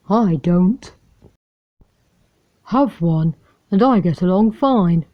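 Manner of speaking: lightly voiced rough stray dog; a hint of a gruff streetwise dog. A narrator's reading. The stray dog sizing up Lady, lightly rough. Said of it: "a rough voice".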